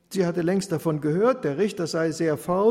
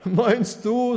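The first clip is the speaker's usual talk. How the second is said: brazen mockery; 7 semitones higher, 7 dB louder than usual. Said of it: "so sprach er ungeniert".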